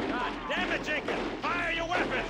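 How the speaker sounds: loud, high furious shout; angry yell. Acted. Sergeant screaming at Jenkins to fire, furious. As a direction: roar at him to shoot. Sergeant yells at frozen soldier.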